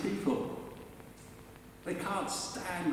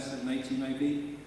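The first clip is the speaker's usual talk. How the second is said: ordinary delivery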